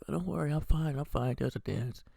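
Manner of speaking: high, bright and chirpy, brushing it off; mock cheery reassurance. Mindy sprinting into frame, cheerfully brushing off her stab wounds, high and chirpy. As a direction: chirp it like nothing happened. Mindy runs in after being stabbed.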